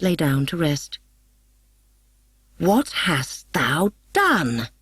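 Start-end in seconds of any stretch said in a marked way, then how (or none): 2.6-4.7 slow, weighty fairy-king demand; lightly voiced displeased king; vexed demand. A narrator's reading. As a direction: press the servant hard, slowly. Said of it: "demanded Oberon, vexed".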